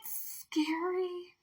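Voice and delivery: high, slow spooky tease; mock spooky. Telling viewers shadow work is scary, in a mock spooky voice. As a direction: drag it out like a ghost story. Spooky dramatized warning after laugh.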